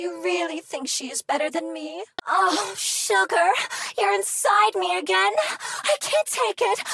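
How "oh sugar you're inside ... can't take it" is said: breathy high mock moan; mock ecstasy. Flirty machine pretends to melt for him, hammed-up high moan. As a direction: overplay the swoon, way up high.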